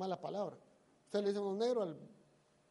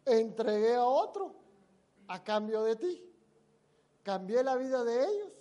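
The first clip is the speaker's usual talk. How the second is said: slow, much louder scripture reading; preaching a verse; 3 semitones higher, 10 dB louder than usual. Acted. Preacher reading the verse to the friend, slow and booming. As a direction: thunder the verse out slowly. Reads Bible verse forcefully.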